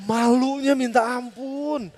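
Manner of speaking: very slow, stretched-out mortification; drawn-out embarrassment. Retold or imitated. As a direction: drag out every word in shame. Reliving his embarrassment once more.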